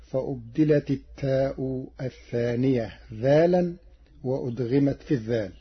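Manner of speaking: usual speaking voice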